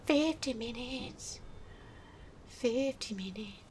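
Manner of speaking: very soft, slow repetition; deflated murmur. Real, not acted. Gamer absorbing time wasted, deflated murmur. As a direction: let it drain to near nothing.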